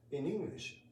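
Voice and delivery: usual speaking voice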